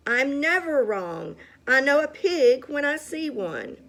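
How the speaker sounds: loud, high, rising indignant yell; self-righteous shout. A narrator's reading. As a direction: bellow it with total certainty. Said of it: "Eugenia shouted".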